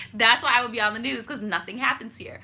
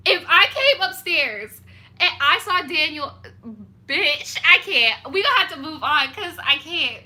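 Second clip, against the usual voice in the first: worked up; 6 semitones higher, 5 dB louder than usual. Real, not acted. Imagining catching Daniel.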